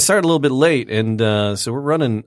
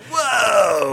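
startled whoa; 7 semitones higher than usual. Retold or imitated. Dog voiced reacting.

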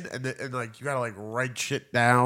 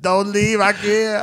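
mock plea; 6 semitones higher, 4 dB louder, slower than usual. Mock breakup plea to ikea.

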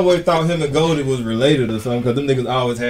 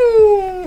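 high sharp pew; gunshot sound effect; 4 dB louder than usual. Acted. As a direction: fire a mouth gunshot. Imitates a gunshot.

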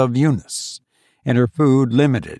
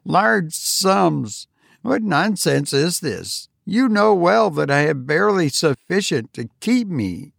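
higher, scornful miser's retort; exasperated old miser, voiced higher; 7 semitones higher than usual. A narrator's reading. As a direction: scoff at the very idea. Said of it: "the exasperated Tabitha".

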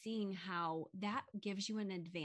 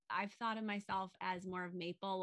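ordinary talk, nothing marked

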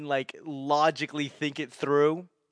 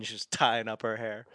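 ordinary talk

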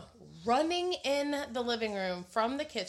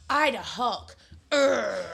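put-on toddler Hulk growl; 3 semitones higher, 6 dB louder, slower than usual.

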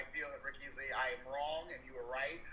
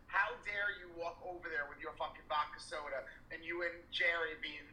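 usual speaking voice

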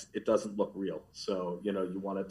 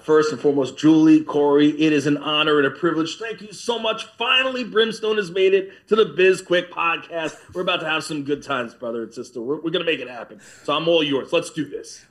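hyped wrestler promo; 6 semitones higher, 6 dB louder than usual. Acted. Wrestler greets hosts in hype.

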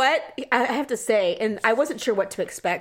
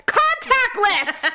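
high, loud, drawn-out exclamation; indignant mock shout; 11 semitones higher, 3 dB louder, much slower than usual. Customer to a driver who knocked, mock outraged yell. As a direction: stretch it out, hollering through the door.

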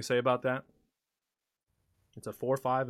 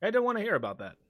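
higher, prim rebuke; uncomfortable host; 8 semitones higher, much faster than usual. Acted. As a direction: shut the topic down. NPC rebuffs crude talk.

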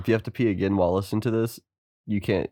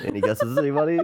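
excited; 8 semitones higher, faster than usual. Acted. Echoing the host, rising excitedly.

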